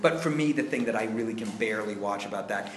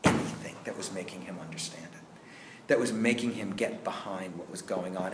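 ordinary delivery

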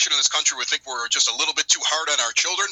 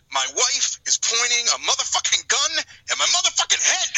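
retold scream; 9 semitones higher than usual. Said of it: "he just, like, screams it".

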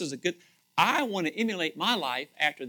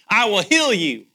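"I will heal you" loud and higher, stress on heal; joyful proclamation; 4 semitones higher, 11 dB louder than usual. Shown as a demonstration. Preacher repeating God's promise to the church, loud and joyful. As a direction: proclaim it loudly, leaning on heal. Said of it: "That is really good news".

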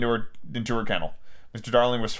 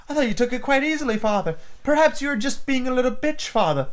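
put-on high-pitched child questioning father; puzzled girl; 9 semitones higher than usual. Daughter doubts her father's excuse, puzzled. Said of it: "Wendy was quite puzzled".

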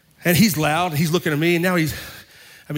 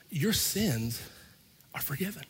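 much softer, almost whispered; soft reveal; 9 dB quieter, slower than usual. Retold or imitated. Preacher hushing the good news to the angry man. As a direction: drop the voice to a hush on forgiven. Punchline told to stranger.

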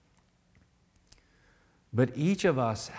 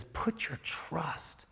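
slow unvoiced invitation; soft whispered plea; 4 semitones higher, 9 dB quieter, much slower than usual. Preacher voices the quiet invitation to trust. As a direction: breathe it gently.

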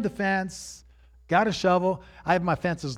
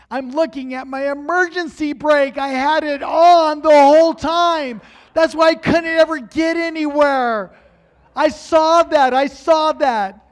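loud, high outburst of discovery; raised voice, reliving a sudden realization; astonished shouting; 9 semitones higher, 10 dB louder than usual. Retold or imitated. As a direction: shout it like you just spotted it.

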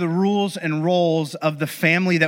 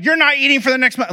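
high, fast mock scolding; imitated angry yell; 8 semitones higher, 4 dB louder, faster than usual. Parent handing out big consequences to the family, yelling. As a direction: rattle off the punishment like an irate parent. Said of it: "And, like, yell".

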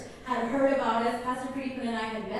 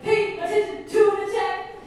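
mock urgent yell; 8 semitones higher, 5 dB louder than usual. Retold urging husband to pay attention.

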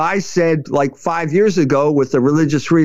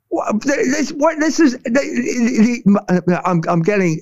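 choking up; 5 semitones higher than usual. Real, not acted. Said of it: "I'm choking up here with outrage".

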